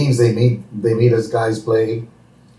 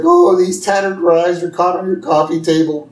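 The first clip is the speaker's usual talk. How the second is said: lightly voiced Homer, a touch higher and mopey; a hint of Homer feeling sorry for himself; 7 semitones higher, 3 dB louder than usual. Homer snagged on the coffee table, mildly voiced. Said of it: "He's like".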